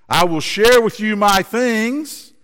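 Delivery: high, slow friendly call. Acted. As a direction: call out the offer across the valley. Boy calls kindly to echo.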